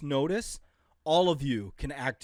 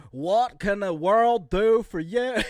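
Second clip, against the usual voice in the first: much higher and louder, slow; loud mock announcement; 8 semitones higher, 13 dB louder, slower than usual. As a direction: boom it out slowly like a showman. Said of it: "I was like".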